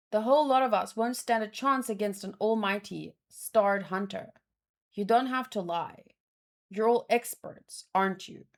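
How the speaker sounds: ordinary delivery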